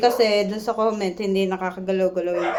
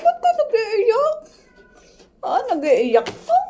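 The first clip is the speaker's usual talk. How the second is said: much higher sing-song pet talk; 11 semitones higher, 3 dB louder than usual. Real, not acted. Baby talk to crying cat.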